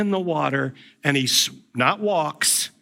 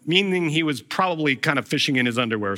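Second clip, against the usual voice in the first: ordinary delivery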